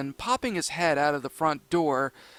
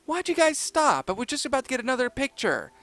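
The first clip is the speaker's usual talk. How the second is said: put-on high girl voice; 8 semitones higher than usual. A narrator's reading. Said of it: "Uniqua said".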